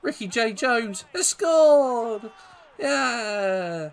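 high, loud, building to a drawn-out shout on scored; excited goal call. Real, not acted. Gamer cheering his own unexpected goal, excited shout. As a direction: celebrate loudly, stretching out the big word.

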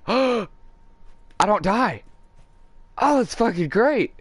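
high, loud gasped amazement; gasp, then exclaim in high-pitched disbelief; real astonished relief. Real, not acted. Player finds the missed jump harmless, real astonishment. Surprised at surviving a drop.

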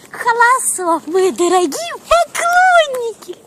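put-on high, loud, slow Chinese accent; chinese accent russian. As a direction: greet the fans in a hammed Chinese accent. Mock foreign greeting to fans. (с китайским акцентом)

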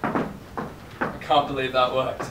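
ordinary delivery

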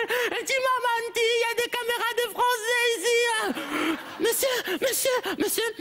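high, keen schoolkid calling sir; eager pupil. Acted. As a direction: call for the teacher like a child.

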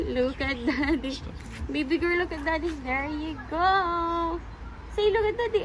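ordinary talk

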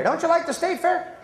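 rally the audience; calling out. Real, not acted. Comic calls out to audience.

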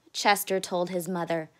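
usual speaking voice

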